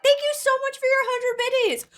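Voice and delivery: high, loud gushing thanks; thanking a viewer for bits, thrilled; excited thanks. Real, not acted. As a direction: burst out with gratitude.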